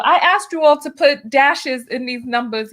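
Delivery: ordinary talk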